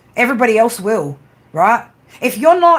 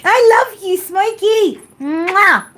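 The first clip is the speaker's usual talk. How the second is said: cooing to the cat; 9 semitones higher, 3 dB louder, much slower than usual. Real, not acted. Streamer cooing love at her cat Smokey, high baby talk. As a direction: coo it up high like kissing a kitten.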